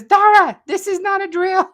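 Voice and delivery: hyped-up fan announcing big news; excited alarm. Retold or imitated. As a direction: sound the alarm with a grin. Reading an excited fan comment.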